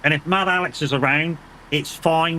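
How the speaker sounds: ordinary delivery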